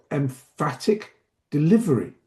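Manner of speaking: "emphatic" punched on its middle syllable, then a pause; emphatic delivery. Shown as a demonstration. Trainer models emphasis for teachers, "emphatic" stressed and spaced. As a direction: lean hard on "emphatic", set "delivery" apart. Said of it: "That was emphatic when I said emphatic delivery".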